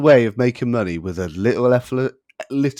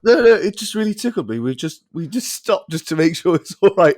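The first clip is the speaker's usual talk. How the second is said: ordinary talk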